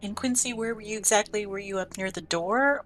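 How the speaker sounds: ordinary talk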